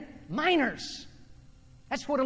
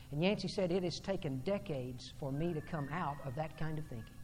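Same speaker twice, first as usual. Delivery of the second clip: low, hushed retold confession; quiet, reflective preaching voice; subdued quoting; 7 semitones lower, 10 dB quieter than usual. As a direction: drop low and soft as you quote him. Retells the author's confession.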